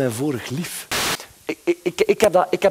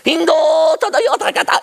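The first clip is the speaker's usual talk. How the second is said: gibberish; 4 dB louder than usual. Acted. Mock gibberish language, laughing.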